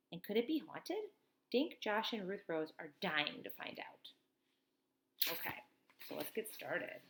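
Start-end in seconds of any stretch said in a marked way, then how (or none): none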